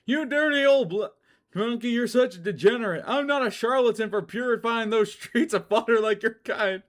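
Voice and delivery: high, loud sneering villain; high, loud contempt; Starlo's voice. Acted. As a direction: sneer it down at him. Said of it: "Copped out Starlo".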